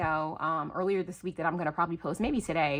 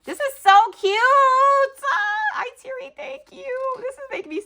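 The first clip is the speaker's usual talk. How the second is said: high, teary-happy gushing; overwhelmed with joy at a gift, near tears; on the verge of happy tears; touched squeal; 6 dB louder than usual. Real, not acted. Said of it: "she's gonna make me cry".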